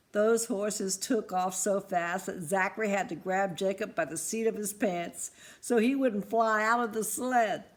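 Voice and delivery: ordinary delivery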